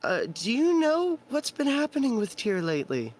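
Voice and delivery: high-pitched, uneasy female voice; woman clerk. Acted. Clerk quizzing the new arrival about his deity, high female voice. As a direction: ask delicately, pitched well up.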